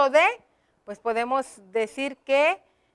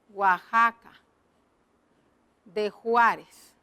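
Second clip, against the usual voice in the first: slow dictation; 4 semitones lower, slower than usual. Shown as a demonstration. Writing the place name in the box.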